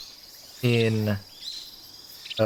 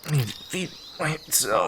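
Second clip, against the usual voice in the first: Snake voice; 5 dB quieter than usual. Retold or imitated. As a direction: lightly growl it like Snake. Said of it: "doing the Snake voice".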